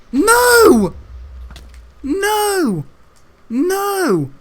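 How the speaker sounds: high, loud cry of no; yelling at the screen in dismay; real shouted no. Real, not acted. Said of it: "shouting at the game".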